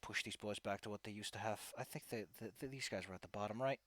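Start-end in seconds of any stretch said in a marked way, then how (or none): none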